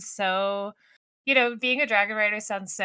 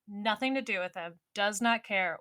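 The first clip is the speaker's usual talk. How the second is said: ordinary talk, nothing marked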